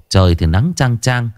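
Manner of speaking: ordinary delivery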